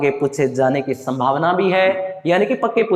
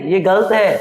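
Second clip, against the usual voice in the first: ordinary talk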